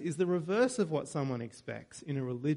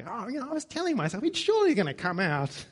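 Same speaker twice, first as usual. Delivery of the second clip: higher, fast, self-important inner monologue; hammed-up pompous internal dialogue; mock inner voice; 6 semitones higher, faster than usual. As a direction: act out Naaman's smug thoughts. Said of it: "I love the internal dialogue".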